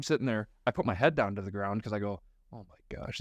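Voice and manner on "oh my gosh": breathless whispered oh my gosh; embarrassed whisper. Retold or imitated. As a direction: whisper it so nobody near hears. Embarrassed crying in church.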